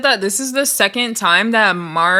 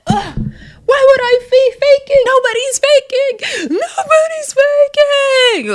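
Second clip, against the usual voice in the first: loud, very high mock wail; mock tantrum; 5 dB louder than usual. [name] denying she is faking, hammed-up crying wail. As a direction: wail it out, dragging the last words.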